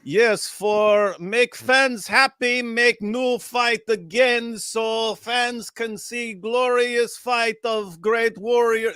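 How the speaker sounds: put-on slow, high Ukrainian-accented broken English. Ukrainian boxer answering a reporter, slow and high in a Ukrainian accent. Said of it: "that's how he talked".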